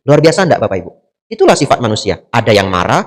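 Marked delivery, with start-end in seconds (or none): none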